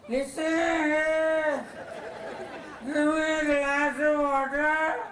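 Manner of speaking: high, pained call for water, slowed. Acted. Sick husband calling his wife for water, high and pained.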